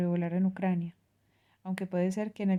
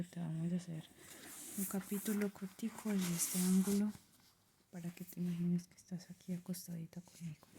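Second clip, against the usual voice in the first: hushed, intimate ASMR voice; soft-spoken ASMR; 8 dB quieter than usual. Acted. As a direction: speak softly, close to the mic. Soft-spoken intro to bedtime reading.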